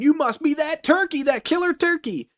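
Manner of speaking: lightly voiced movie character. Podcaster acting Billy spotting the killer turkey, lightly voiced character. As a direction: accuse it in a put-on high voice.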